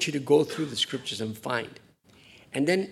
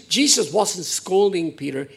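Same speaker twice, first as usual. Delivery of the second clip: ordinary talk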